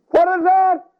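put-on high, loud frightened child's cry; child startled by the thunder, scared and high. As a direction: squeal it like a terrified little kid.

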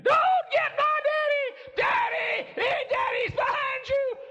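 put-on piercing, very high child's scream; drawn-out high screaming, full volume; screaming little girl. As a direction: shriek a frantic warning as a small child. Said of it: "I can recall Becky screaming".

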